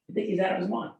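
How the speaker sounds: ordinary talk, nothing marked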